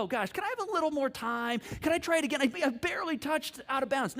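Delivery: high-pitched mock bargaining; pleading excuse-making.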